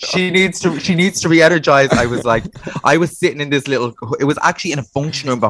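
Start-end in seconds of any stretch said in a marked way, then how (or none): 0.1-1.9 mock Milton voice. Continuing Milton quote, high.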